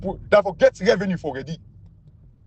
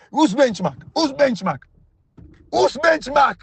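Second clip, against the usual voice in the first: rising outrage, each repeat higher; indignant challenge; 8 semitones higher, slower than usual. Real, not acted. Pundit to the government officials, outraged challenge.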